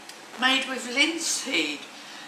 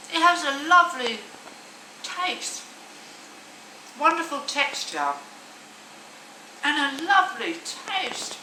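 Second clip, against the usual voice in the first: delighted; 6 semitones higher than usual. Real, not acted. First bite of the bread.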